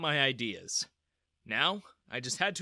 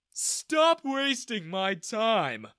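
bored yawn; 8 semitones higher, 6 dB louder, much slower than usual. Acted. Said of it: "he yawned".

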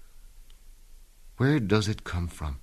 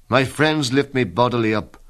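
ordinary talk, nothing marked

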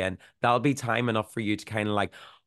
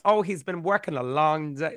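put-on higher, fluttery Southern Belle accent; 6 semitones higher than usual. Nineteen thirties housewife fussing before Edward gets home, Southern Belle accent. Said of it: "I don't know why I went Southern Belle".

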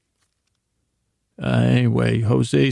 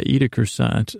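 ordinary talk

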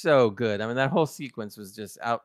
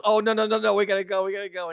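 higher, hurried "no, no, no" as a fleeing Boimler; mock panic; 7 semitones higher, faster than usual. Host imitating Boimler panicking to escape the room, mock panic. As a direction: yelp it like you need to escape now.

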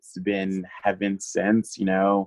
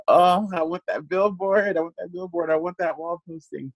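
wistful wanting; 8 semitones higher than usual. Retold or imitated. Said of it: "But I was like".

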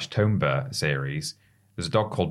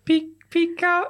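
very high squeaky Pikachu voice. Retold or imitated. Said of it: "Pikachu spoke".

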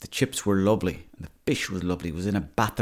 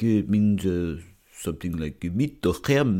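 mock French accent; slower than usual. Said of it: "they're like".

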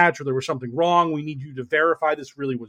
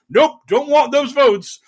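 mock defiant candidate; 9 semitones higher, 3 dB louder than usual. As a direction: slam the door on those voters. Voicing Kari rejecting votes.

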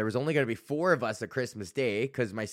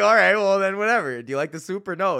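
loud high huffy comeback; hammed-up annoyed cook; exasperated retort; 7 semitones higher, 7 dB louder than usual. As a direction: push back, fed up, louder. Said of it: "I'm like".

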